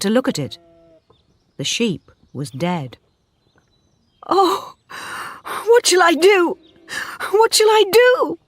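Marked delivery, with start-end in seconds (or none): 4.3-4.7 very high anguished cry; anguished gasp of a word; cried out. A narrator's reading. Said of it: "she cried". 5.6-6.5 very high, loud desperate cry; frantic cry; cried. A narrator's reading. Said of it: "she cried". 7.3-8.4 higher still, near breaking; desperate climbing cry; cried, rising further. A narrator's reading. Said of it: "she cried".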